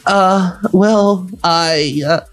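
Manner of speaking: put-on high, halting girl voice; mildly flustered, trailing hesitation; embarrassed mumble. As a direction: stammer shyly up high. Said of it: "mumbled Selfie as she blushed".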